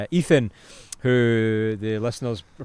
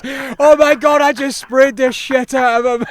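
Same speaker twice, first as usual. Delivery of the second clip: high, loud crowing; gleeful mock shouting; 11 semitones higher, 6 dB louder than usual. Friend mimicking the surfer's bragging, gleeful high shout. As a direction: crow it in a mocking, over-excited yell.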